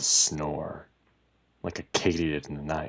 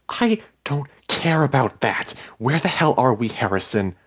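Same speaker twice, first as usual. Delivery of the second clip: loud, raised, impatient voice; heated, high-pitched snapping; exasperated demand; 8 semitones higher, 7 dB louder than usual. A narrator's reading. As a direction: cut him off and demand an answer. Wife demands answers, exasperated.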